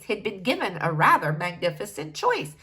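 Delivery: usual speaking voice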